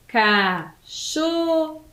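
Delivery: CACHORRO very slowly split into CA, CHO, RRO; syllable by syllable. Shown as a demonstration. Teacher reveals the animal to pupils, syllable by syllable. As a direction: stretch and separate each syllable of CACHORRO. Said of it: "esse número de letras e de sílabas".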